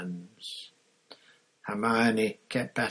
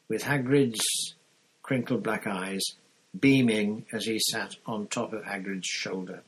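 plain reading voice

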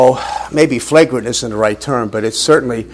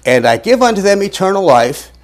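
slow, louder, stretched reading; emphatic scripture reading; 3 semitones higher, 5 dB louder than usual. Acted. Preacher reading Jesus' words to the class, slow and emphatic. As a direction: lean hard on every phrase.

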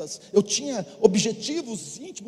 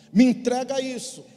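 raised, louder imitated command; firm demand; 4 semitones higher, 7 dB louder than usual. God asking the believer to hand something over, firm demand. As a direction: demand it higher and with force.

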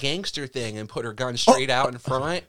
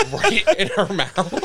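laughing; 7 semitones higher, 6 dB louder than usual. Real, not acted. Laughing while telling the story.

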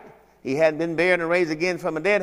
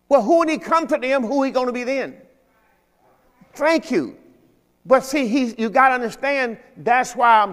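raised questioning preach; high, pressing preacher; 7 semitones higher, 3 dB louder than usual. Acted. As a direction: push the question at the church. Preacher climbs in pitch.

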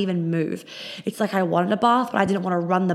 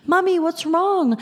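much higher, slow little pleading voice; worried puppy voice; 11 semitones higher, slower than usual. Pretend. Voicing her puppy Jelly asking mummy what's wrong, high and slow. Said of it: "Jelly's looking at me with these puppy dog eyes".

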